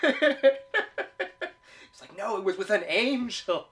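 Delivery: high, loud quote through laughter; hammed-up quote, helpless with amusement; laughing punchline. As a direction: belt out the punchline while cracking up.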